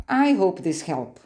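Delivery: ordinary talk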